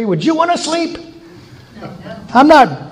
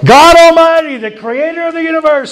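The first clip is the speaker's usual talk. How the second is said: booming proclamation; 8 semitones higher, 4 dB louder than usual. Real, not acted. Booming announcement of God.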